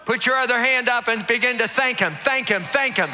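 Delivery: shouted high preaching, driving the crowd; preaching at full force. Acted. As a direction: preach it out loud to the whole room.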